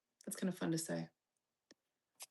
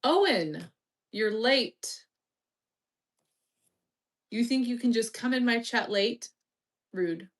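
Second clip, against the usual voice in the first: louder, playful scold; mock scolding; 3 semitones higher, 5 dB louder than usual. Streamer mock-scolding a late viewer. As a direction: tell him off in jest.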